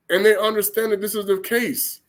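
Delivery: ordinary delivery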